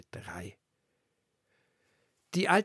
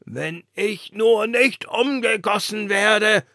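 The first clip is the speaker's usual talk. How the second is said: higher, louder, slow fretting; worried lantern; 10 semitones higher, 10 dB louder, slower than usual. A narrator's reading. The lantern fretting to the wind about recasting. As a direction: dread it, drawn out.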